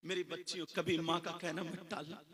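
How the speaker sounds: ordinary delivery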